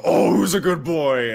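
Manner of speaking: high, loud, drawn-out pet talk; excited dog-praise. Acted. Player coaxing a salamander off the wall, excited dog-praise. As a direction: gush as if calling a dog over.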